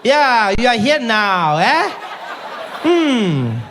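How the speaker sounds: high, loud laughing taunt. Retold or imitated. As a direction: gloat with a laugh.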